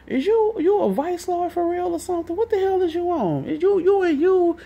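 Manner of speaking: high, strained imitation of a crowd grilling him; confronting question. Everybody confronting Amp.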